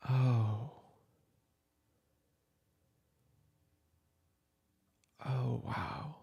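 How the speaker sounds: put-on a gentle older sibling comforting a soaked kid at midnight; an older sibling at midnight, welcoming and comforting the upset kid in from the rain; caring older sibling. Plays a caring sibling at night.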